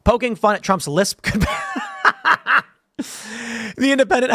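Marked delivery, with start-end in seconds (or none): 1.5-2.6 sudden high-pitched laugh; amused high laugh. Real, not acted. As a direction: burst out laughing. Amused at lisp headline.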